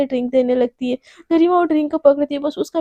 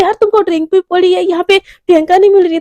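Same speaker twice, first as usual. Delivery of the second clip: louder and higher, exasperated and desperate; a panicked friend losing patience; frantic snapping; 5 semitones higher, 8 dB louder than usual. Acted. Garima snapping at Anaya while frantically searching for Priyanka.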